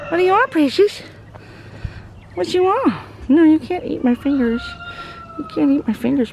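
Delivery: high-pitched cooing to an animal. Real, not acted. Owner to Precious the goat nibbling fingers, high baby talk.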